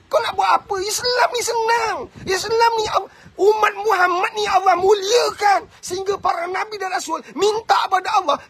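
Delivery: tearful fervent sermon climax; impassioned preaching. Acted. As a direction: preach it at full force, voice cracking with emotion. Preacher builds to impassioned peak.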